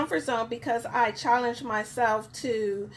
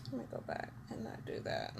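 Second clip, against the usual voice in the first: whisper; 18 dB quieter, much faster than usual. Real, not acted. Fixing setup while live.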